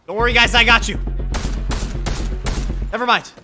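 ordinary talk, nothing marked

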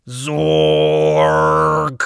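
loud glitchy zork; zork sound. A narrator's reading. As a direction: blare the misfire. Machine misfires.

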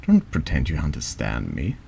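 ordinary talk

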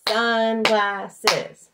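Sun-glass-es, loud, three even beats; three loud clapped beats; syllables clapped out. Shown as a demonstration. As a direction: beat out the syllables for the kids. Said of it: "Are you ready?".

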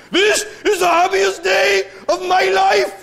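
high, loud, slow Welsh wail, pained even in joy; mock Welsh groom. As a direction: do a Welsh groom sounding pissed off at his own wedding. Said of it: "he sounds pissed off".

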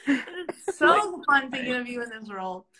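ordinary talk

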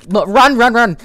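high frantic yell of run; panicked shout. Real, not acted. Player fleeing the monster, panicked high shout. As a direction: scream at yourself to run.

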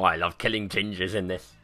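usual speaking voice

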